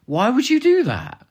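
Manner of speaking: high, loud and slow disbelief; exasperated. Real, not acted. Mocking villains who advertise evil, high, loud and slow. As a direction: throw up your hands at the silliness.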